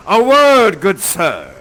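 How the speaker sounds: loud, commanding father; Prospero's stern word. Acted. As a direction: confront the young man. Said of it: "He shows up and says".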